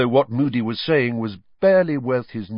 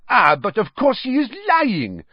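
much higher, louder French-tinged outcry; French headmistress crying out; 14 semitones higher, 6 dB louder than usual. A narrator's reading. Madame Maxime accusing Harry of lying, crying out in a French accent. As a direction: throw the accusation across the room. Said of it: "cried Madame Maxime".

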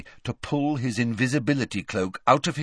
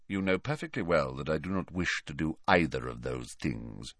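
low, soft cold voice; 5 semitones lower, 8 dB quieter than usual. A narrator's reading. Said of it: "Then Snape said".

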